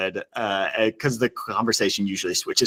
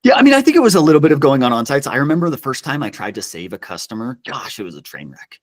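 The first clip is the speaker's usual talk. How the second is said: ordinary delivery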